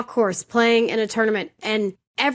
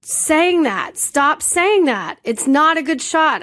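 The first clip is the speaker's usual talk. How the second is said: high, drawn-out exasperated outburst; slow, emphatic retold yell; losing it, raised voice; 4 semitones higher, 3 dB louder, slower than usual. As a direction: re-enact yelling at a friend to stop. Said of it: "raised your voice".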